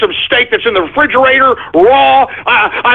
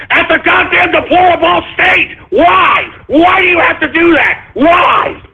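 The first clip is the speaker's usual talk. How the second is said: shouting in real anger, high and raised; angry shout; 6 semitones higher than usual. Real, not acted. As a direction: yell it out in frustration. Ranting at a speech.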